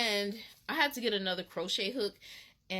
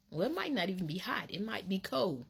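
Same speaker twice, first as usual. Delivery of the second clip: ordinary talk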